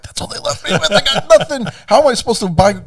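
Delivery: high, squeaky retold complaint; mock despair. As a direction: plead in hammed-up dismay, voice way up. Re-enacting dismay at empty inventory.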